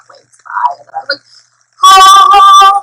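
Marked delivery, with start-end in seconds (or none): 1.8-2.8 high, loud mock whimper; holding back tears. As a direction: act out fighting back a cry. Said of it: "trying not to cry".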